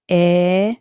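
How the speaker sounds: short loud eh vowel; teacher modelling the short e; eh sound. Shown as a demonstration. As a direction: punch out the eh. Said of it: "eh sound in this word".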